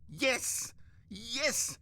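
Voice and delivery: high, emphatic exclamation; impassioned cry. Acted. Narrator answers himself, ranting.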